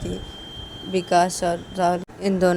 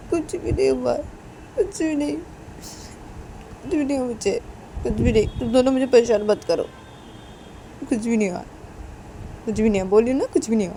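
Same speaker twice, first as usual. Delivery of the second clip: higher, choked voice insisting nothing happened; tearful denial; 5 semitones higher than usual. Acted. Shruti tells her worried brothers nothing happened, tearful. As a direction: push them away through tears. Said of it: "श्रुति बोलती कि".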